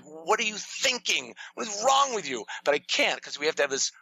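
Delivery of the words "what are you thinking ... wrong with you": exasperated outburst, held back in volume; mock-yell it in disbelief; imagined angry yelling. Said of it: "yell at him and be like".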